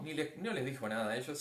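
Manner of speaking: normal talking voice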